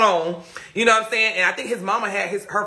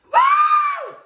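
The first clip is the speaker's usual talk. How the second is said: high woo; 6 dB louder than usual. Real, not acted. Excited exclamation mid-story.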